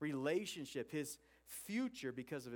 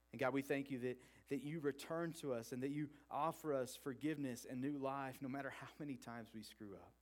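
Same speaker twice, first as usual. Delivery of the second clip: gentle spoken prayer, voice easing down; 3 semitones lower than usual. Real, not acted. Leads the congregation in prayer.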